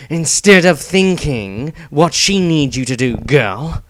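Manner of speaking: brusque warrior putting her in place; a gruff fighter barking at the girl; sharp retort. A narrator's reading. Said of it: "Dieter shot back".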